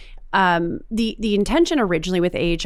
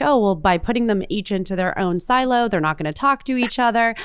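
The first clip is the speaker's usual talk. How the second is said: ordinary delivery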